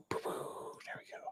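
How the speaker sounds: whispered to self. Real, not acted. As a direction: breathe it under your breath. Muttered to self while opening a file.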